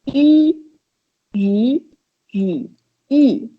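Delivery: yu level, rising, dipping, then falling; four tones in turn. Shown as a demonstration. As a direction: run yu through the four Mandarin tones. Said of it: "let's start with those four".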